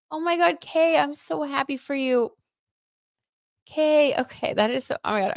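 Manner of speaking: high, tearful rush of joy; almost crying with happiness for her; near tears with joy. Real, not acted. To Kay about her dog's good news, high and tearful. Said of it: "not gonna cry".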